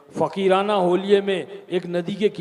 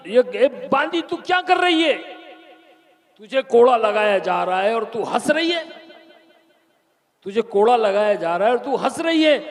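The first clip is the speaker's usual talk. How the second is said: high, loud baffled shout at the maid; shouting king; 5 semitones higher, 4 dB louder than usual. Acted. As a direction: bellow in disbelief. (हैरान बादशाह की ऊँची चीख)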